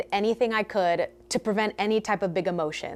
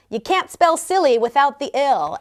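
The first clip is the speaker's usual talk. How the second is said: ordinary talk, nothing marked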